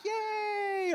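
high, shouted cheer; squealing ringside yay; hype guy cheer. Retold or imitated. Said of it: "I'm the hype guy in the back".

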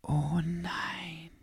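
soft, breathy frightened whisper; breathed whisper. Acted. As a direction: breathe it out in fear. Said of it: "hauchte Henry".

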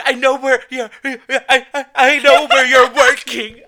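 high, loud stuttering; imitating her friend's panicked phone call; mock stammering panic.

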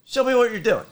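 high, loud demand from the chief; chief's demand. Retold or imitated. Chief demands to see the model, high loud mock order. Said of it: "He was like".

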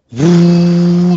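long, loud, high horn "woo"; imitated horn blast. Film fan showing the sudden sound, imitated horn blast. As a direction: mimic a big horn blast, held out. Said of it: "these real big horn blasts".

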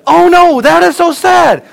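put-on very high, loud, slow little-girl voice; little daughter. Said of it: "and then she says".

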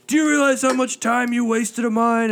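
loud, high indignant telemarketer; furious scammer. Retold or imitated. Angry telemarketer yelling at the prank caller, loud and high. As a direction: blow up at the time-waster. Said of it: "The guy got so pissed at him".

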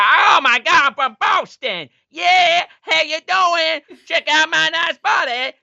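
hammed-up Boston girl shouting; mock Boston girl. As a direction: squeal it like a loud Boston girl. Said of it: "she's like".

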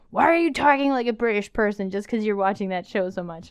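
hint of a husband's teasing complaint; imitating husband. Wife quoting husband teasing her British talk, lightly mocking. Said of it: "That's what he sounds like".

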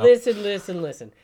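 loud, high demand to be heard; insistent interruption. Real, not acted. Podcaster objecting to co-host's cowboy jab, insistent.

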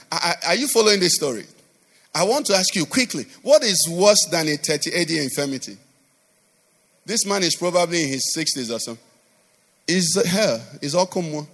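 normal talking voice

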